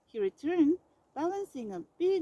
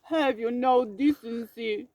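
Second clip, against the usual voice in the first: loud, outraged bawl; 8 dB louder than usual. A narrator's reading. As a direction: bawl it sitting bolt upright. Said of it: "bawled Bear".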